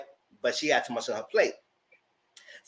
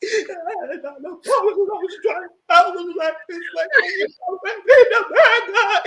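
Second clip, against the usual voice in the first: much higher, squeaky laughing imitation; laughing mock cry; 8 dB louder than usual. Friend imitates the crying star while laughing. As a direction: cackle through a mock tearful squeal.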